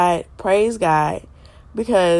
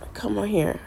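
ordinary talk

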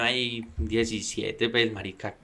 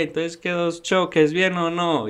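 higher, slower, teasing father; amused dad; 6 semitones higher, slower than usual. Retold or imitated. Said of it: "entró mi papá cagado de la risa". (papá burlón y divertido, más agudo)

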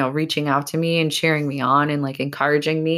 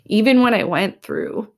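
higher and louder, voice catching; fighting back real tears on the words; choked up, real tears; 6 semitones higher, 4 dB louder than usual. Real, not acted. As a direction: emotion breaking through, higher pitch. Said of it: "I'm, like, getting a little emotional".

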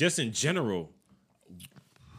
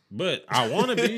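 laughing, higher and louder; 10 semitones higher, 7 dB louder than usual. Real, not acted. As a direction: laugh through the words. Laughing at the wedding snub.

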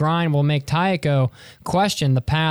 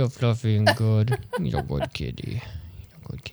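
much lower, soft pet talk; praising the cat; 7 semitones lower, 5 dB quieter than usual. Real, not acted.

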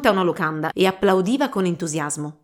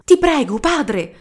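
higher, louder begging cry; pleading daughter; 6 semitones higher, 4 dB louder, slower than usual. Acted. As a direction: beg the father desperately. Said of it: "la supplicò Chitri".

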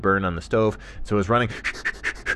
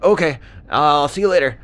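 high, loud dingo voice; breezy loud sign-off, pitched up; cheery dingo goodbye; 6 semitones higher, 7 dB louder than usual. Acted. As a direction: call a quick farewell.

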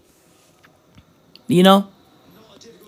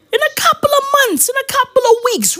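mock crying; faster than usual.

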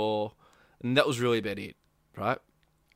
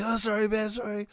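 mock apology; 9 semitones higher than usual. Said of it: "And I was like".

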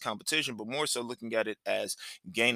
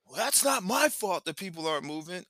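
high, whiny mock comeback; protesting son; 7 semitones higher than usual. Dad imitating his son's reply about stalled traffic, whiny protest. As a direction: imitate a kid arguing back in a raised pitch. Son argues back in retold quarrel.

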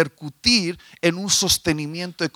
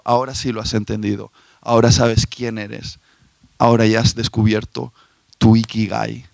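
voice of the divine being; 8 semitones lower than usual. Acted. Divine voice answers the woman.

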